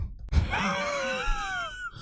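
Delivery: high, shrieking burst of laughter; a sudden squealed laugh of recognition. Real, not acted. (schrilles, hohes Auflachen)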